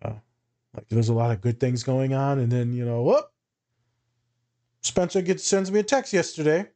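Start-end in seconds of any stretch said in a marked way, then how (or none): 3.0-3.3 sudden high whoop, like a trap springing; a quick rising whoop for the bad twist; a bright yelp for the other shoe dropping; mock whoop. Sudden bad turn in the news.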